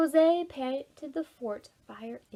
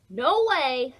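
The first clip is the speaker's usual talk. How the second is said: loud, higher "no way"; loud refusal; 3 semitones higher, 10 dB louder than usual. A narrator's reading. Jose to Ruby, a loud, higher refusal. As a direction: shout her down.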